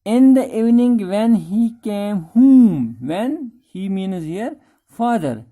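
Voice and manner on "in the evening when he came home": slow, deliberate reading of the text; reading the story line by line; slow reading aloud. Shown as a demonstration. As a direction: read each word out slowly for the class. Reads the English text aloud.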